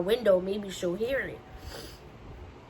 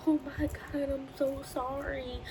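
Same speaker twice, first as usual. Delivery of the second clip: higher, softer distraught apology; apologetic mom; 7 semitones higher, 4 dB quieter than usual. Retold or imitated. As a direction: play the mom overwhelmed and sorry.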